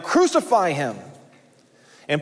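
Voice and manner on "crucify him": mildly raised voice of a crowd; crowd crying out. Acted. Preacher voicing the crowd before Pilate, a restrained cry. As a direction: lightly imitate a crowd's outcry. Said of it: "this is the crowd crying out".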